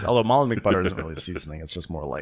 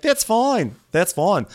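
high, tight reassurance; hammed-up hostess pretending it's fine; mock forced calm; 11 semitones higher, 4 dB louder, slower than usual. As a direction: insist it's fine through teeth. Imitating Katie forced calm.

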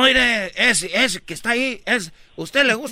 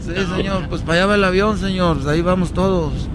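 drowsy man; 3 semitones lower than usual. Acted. Passenger replies in other voice.

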